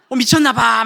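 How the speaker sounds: high, loud mock-shocked cry; shocked sisters. Sisters at Bible camp reacting to the pastor's story, mock shocked. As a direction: play the gasping women in the pews. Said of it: "막 이런, 이게 표정으로".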